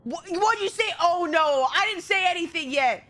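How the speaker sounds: ordinary talk, nothing marked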